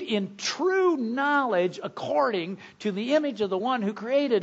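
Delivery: high-pitched, forceful pulpit reading; emphatic scripture reading. Acted. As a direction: proclaim each verse to the congregation. Preacher declaims a run of verses.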